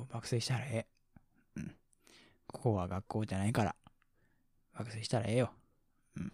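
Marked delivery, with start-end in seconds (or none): 2.5-6.3 low, offhand Kansai speech; casually self-assured in Kansai dialect. Real, not acted. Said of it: "関西弁になったら。 なんかすごい偉そうやろ". (くだけた関西弁で少し偉そうに)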